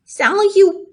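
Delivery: high, loud startled piglet; startled cry. Acted. Wilbur startled by the voice, crying out. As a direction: jump at the strange word. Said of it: "he cried".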